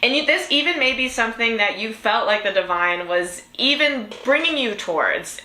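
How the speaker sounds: usual speaking voice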